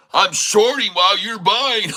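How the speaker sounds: high, mocking voice of a smug short seller; mocking impression. Mocking the trader who bragged about shorting, high sneering voice. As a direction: mimic the cocky trader with a sneer. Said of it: "talking about how smart he was".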